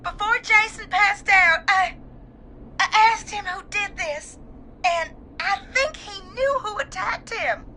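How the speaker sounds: high, crying account, haltingly told; tearful. Acted. Caller tells host what Jason revealed, tearful. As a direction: get the story out through tears. Caller recounts dying friend's words.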